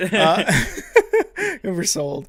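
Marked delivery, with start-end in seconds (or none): 0.5-1.6 laugh. Real, not acted. Laughs at own burping.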